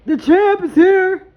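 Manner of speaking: slow, high and loud ring-announcer boom; hype champ call. Pretend. Reading cards and hyping the viewer's win with a loud champ call. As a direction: announce the champion to the whole arena.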